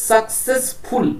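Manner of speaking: successful broken up into success and ful; split into syllables. Shown as a demonstration. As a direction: slowly separate the parts of successful. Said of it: "then ফুল ওয়ার্ডটা separate". (successful, ভেঙে ভেঙে ধীরে)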